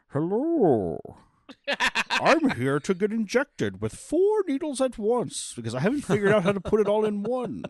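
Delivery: Bane impression. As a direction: announce it big and high as a comic supervillain. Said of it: "just going straight Bane for Mister Hyde".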